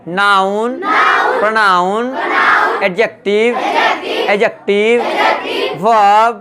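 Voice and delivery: each word stretched, lifting at the end; drawn-out repeat-after-me delivery; say-along drill. Shown as a demonstration. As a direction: lead the class word by word in a chant. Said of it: "फिर से आइए".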